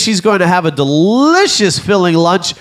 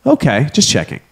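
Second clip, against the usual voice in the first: ordinary delivery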